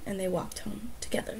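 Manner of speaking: whispered story close; whispered ending. A narrator's reading. Narrator softly ending the tale for listeners in a whisper. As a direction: breathe the last line out.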